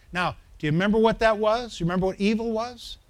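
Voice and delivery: normal talking voice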